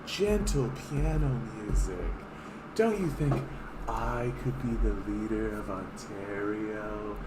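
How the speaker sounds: hushed, slow mock campaign-ad voice; softly parodying a soft-sell candidate. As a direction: whisper it like a gentle political ad. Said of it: "piano music and soft, calming statements".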